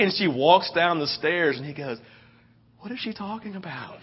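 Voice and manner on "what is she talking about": very soft, raised-pitch confusion; hushed, puzzled stage aside; baffled aside. Retold or imitated. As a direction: imitate a clueless husband under his breath. Clueless husband's aside behind her back.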